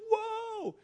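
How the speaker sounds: long, high-pitched held whoa; awestruck Nathanael. Acted. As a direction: draw it out high in amazement.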